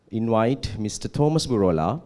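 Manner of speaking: ordinary talk, nothing marked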